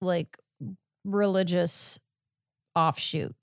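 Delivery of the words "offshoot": ordinary talk, nothing marked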